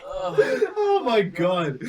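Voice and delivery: laughing through tears; cry-laughing in shock. Real, not acted. Reacting to burning nasal spray.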